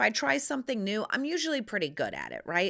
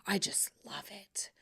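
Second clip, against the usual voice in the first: whisper; 9 dB quieter than usual. Real, not acted. Whispered aside about reupholstery porn.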